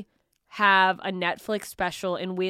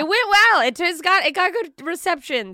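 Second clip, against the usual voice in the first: much higher, louder proud boast; proud brag; 9 semitones higher, 6 dB louder than usual. Real, not acted. Singer bragging to host about her country song, proud. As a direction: crow it proudly. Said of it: "brag about song".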